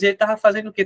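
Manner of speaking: ordinary delivery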